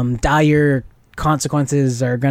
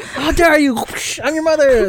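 higher-pitched mock indignation; outraged mother; 11 semitones higher than usual. Telling friends how Scarlet Witch confronts the kid, mock outrage.

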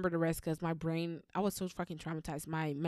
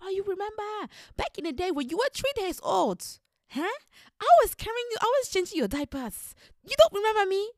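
much higher, loud, sing-song auntie; 13 semitones higher, 6 dB louder than usual. Retold or imitated. Auntie greeting a child at family gatherings, high gushing voice. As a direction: play the gushing church auntie. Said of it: "that auntie that says".

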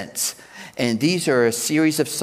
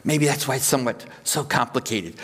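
normal talking voice

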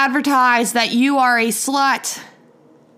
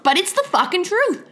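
ordinary talk

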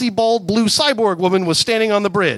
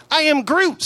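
higher squeaky plant voice "I am Groot"; 10 semitones higher than usual. Acted. Groot interrupting the couple, squeaky high voice. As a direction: chirp it like a tiny tree. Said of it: "squeaky voice plant thing".

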